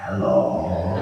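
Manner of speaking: low, strange creepy hello; weird voice. Retold or imitated. Nutcase at the bus station greeting him, low and strange. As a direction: drop into an odd low voice. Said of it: "this voice, and it goes".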